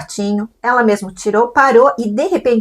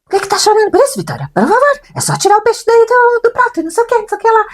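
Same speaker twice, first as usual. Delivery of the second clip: much higher, louder put-on scolding voice; scolding husband; 4 dB louder, faster than usual. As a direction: scold the crying child impatiently. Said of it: "E aí o meu marido logo". (marido dando bronca na filha, voz aguda e alta)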